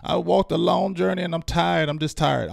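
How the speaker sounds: mock-tired old-timer lament; weary grandparent. Fan imitating a grandparent, tired of losing. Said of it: "your grandparents say".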